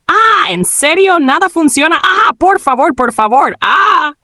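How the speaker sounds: high, drawn-out mock whining; playful complaint. Gamer whose game keeps failing, playfully complaining. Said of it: "se quejó riendo".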